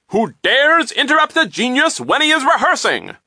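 high, booming outraged bellow; loud, booming voice. A narrator's reading. Interrupted violinist to children at his door, loud and booming. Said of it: "in a voice so loud and booming".

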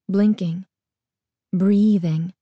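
usual speaking voice

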